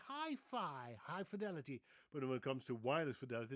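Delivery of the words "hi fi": "Hi-fi" higher, both vowels like eye; "Hi-fi" with eye vowels, as the Dutch say it; Dutch pronunciation. Shown as a demonstration. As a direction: say "Hi-fi" pitched up. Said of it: "Guess what the Dutch say?".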